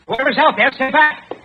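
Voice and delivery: loud high warning call, rising each time; shouted warning. Acted. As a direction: yell it into the darkness. Calling out warning into the dark.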